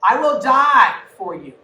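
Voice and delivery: loud, slow vow of loyalty; preacher voicing Peter's loud, slow vow to Jesus; bold declaration. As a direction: declare it like a promise to the death. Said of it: "I don't know why you had to say it that way".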